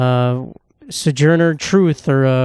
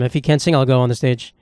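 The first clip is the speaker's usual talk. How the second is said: ordinary talk, nothing marked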